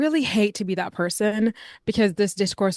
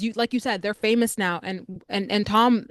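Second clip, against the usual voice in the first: ordinary talk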